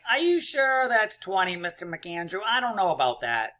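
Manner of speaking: high-pitched put-on kid's voice; mock doubtful student. Pupil doubting Mister McAndrew's twenty, mock skeptical voice. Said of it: "why I talk like that when I talk like you".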